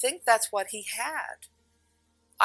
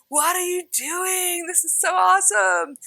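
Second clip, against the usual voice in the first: amazed man; 11 semitones higher, 6 dB louder than usual. Retold or imitated. Client marvels at the energy work, high and excited. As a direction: play him thrilled and astonished.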